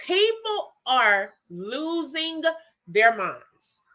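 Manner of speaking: ordinary talk, nothing marked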